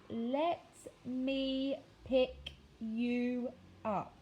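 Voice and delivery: each word drawn out, very slow; very slowly. Acted. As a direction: spell it out word by word, very slowly, for a boy who barely understands. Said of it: "said very slowly".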